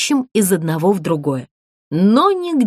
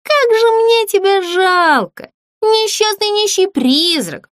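sly mock pity; 7 semitones higher than usual. A narrator's reading. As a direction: needle the ghost with sugary sympathy. Злюня хитро дразнит призрака. (притворная жалость, хитро и насмешливо)

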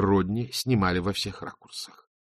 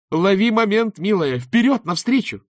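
high, loud cheerleading; over-the-top encouragement; cheering host; 11 semitones higher, 6 dB louder than usual. Acted. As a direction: pump her up like a TV host.

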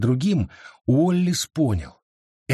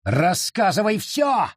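high, slow furious yell; 7 semitones higher, slower than usual. A narrator's reading. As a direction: bellow the demand at her. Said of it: "заорал он".

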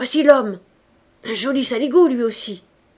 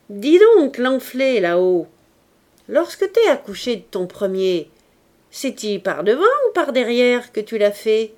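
jeering; 7 dB louder than usual. A narrator's reading. Victoire jeering up at pregnant Adèle, loud. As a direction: jeer up at the window.

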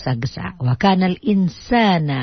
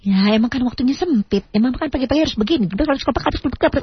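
fast and higher, rushing; hurried mother; 8 semitones higher, faster than usual. Retold or imitated. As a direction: rattle it off like a hurried mum. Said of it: "Mama ngomongnya aja juga tuh buru-buru". (ibu bicara cepat terburu-buru)

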